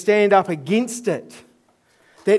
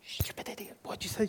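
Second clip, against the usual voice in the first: muttered under his breath, unvoiced; whispered self-scolding; 17 dB quieter than usual. Scolding himself in a whisper after blurting it out. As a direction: scold yourself in a hushed aside.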